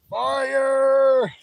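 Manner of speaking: high, loud shout of fire; a gleeful high yell at the flames; excited shout. Real, not acted. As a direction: call out fire with a thrill. Calls out as the fire flares.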